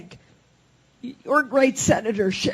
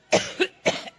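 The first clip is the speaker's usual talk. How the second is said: breathy unvoiced cough; real cough; coughing fit. Real, not acted. Coughs through her speech.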